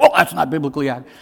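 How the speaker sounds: mock-alarmed, loud, rushed objection; freaking-out theologian. Pastor mimicking theologians who object to a movie, freaking out. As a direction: play a fussy objector in a loud rush. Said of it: "all you theologians are freaking out".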